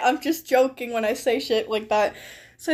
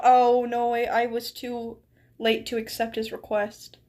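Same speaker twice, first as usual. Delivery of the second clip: ordinary delivery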